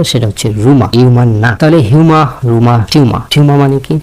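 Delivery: normal talking voice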